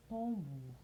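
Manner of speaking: ordinary talk, nothing marked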